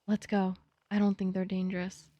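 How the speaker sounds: ordinary talk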